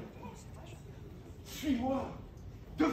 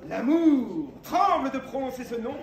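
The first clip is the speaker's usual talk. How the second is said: booming, lofty declaration; grandiose exclamation; 3 semitones higher, 7 dB louder than usual. Acted. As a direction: proclaim it like a knight. Knight exalts love.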